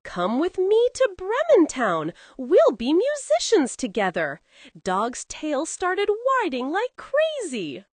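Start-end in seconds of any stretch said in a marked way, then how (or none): 0.0-4.4 higher, louder cheerful invitation; beaming donkey invitation; big smile. Acted. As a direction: smile through it, pulling a friend along. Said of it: "with a big smile".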